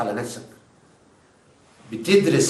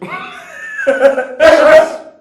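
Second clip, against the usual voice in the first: high, loud burst of laughter; 13 semitones higher, 10 dB louder than usual. Acted.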